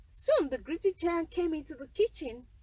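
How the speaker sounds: ordinary delivery, nothing marked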